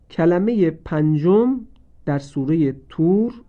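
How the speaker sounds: ordinary delivery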